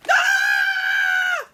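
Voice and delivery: loud high scream; terrified loud scream. Acted. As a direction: shriek in fright at the mirror. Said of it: "Skeleton screamed".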